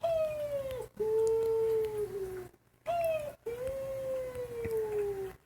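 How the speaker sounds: high repeated owl hoots; owl calls. Retold or imitated. Owl calling back to the dock, high hoots. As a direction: keep hooting back and forth. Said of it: "we hear".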